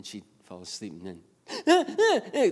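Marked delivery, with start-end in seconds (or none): 1.7-2.5 put-on high, loud toddler whimper; mock crying bursts, pitched way up; imitated fussy cry. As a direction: mimic a sleepy child fussing awake. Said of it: "she wanted to still cry and fight it".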